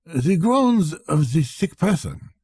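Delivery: a touch of fussy irritation; gentle annoyance. Acted. Accountant complaining about the sick man, mild annoyance. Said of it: "he exhibited a gentle annoyance".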